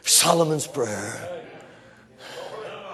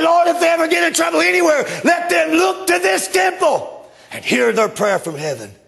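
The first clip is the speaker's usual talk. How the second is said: shouted prayer; 10 semitones higher than usual. Acted. Preacher quoting Solomon's prayer to the congregation, shouting. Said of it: "He said".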